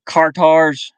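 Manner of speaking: car tars, "tires" flattened to "tars"; Southern accent. Shown as a demonstration. Streamer showing how tires sounded down South, in a Southern accent. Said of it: "or, like".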